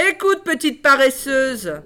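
high, loud, slow scolding; haughty harsh stepmother. Acted. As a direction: look down and lash her with contempt. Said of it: "dit la marâtre d'un ton rogue".

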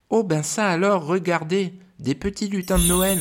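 put-on high, amazed girl's cry; exclaiming. A narrator's reading. Girl spotting the elves, high excited cry. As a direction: gasp it out high with delight. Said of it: "s'exclame Alice".

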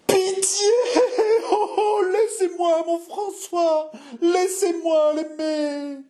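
very high, sobbing melodrama; wailing plea. Acted. Jeanne begging to keep loving François, wailing plea. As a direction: stretch the words out in tearful wails.

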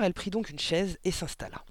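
ordinary delivery, nothing marked

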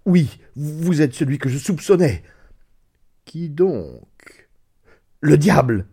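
plus haut, plus fort, terrifié; accuse-le dans la terreur; cri effrayé. Acted. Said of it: "m'écriai-je". (cri de terreur)

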